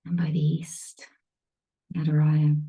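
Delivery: calm meditation guide with long pauses; spacious guided-meditation voice, leaving silence between phrases. Real, not acted. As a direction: ease them into stillness, phrase by phrase. Leads a meditation.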